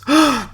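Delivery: high sharp mock gasp. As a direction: gasp in fake shock. Mock shock that Cal appears.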